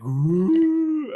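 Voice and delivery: high, ghostly "ooh"; creepy "ooh". Retold or imitated. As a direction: let out an eerie, high-pitched ooh. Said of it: "and then it's creepy".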